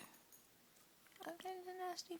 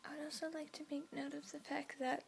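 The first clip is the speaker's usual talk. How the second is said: whispering. Real, not acted. Whispers throughout.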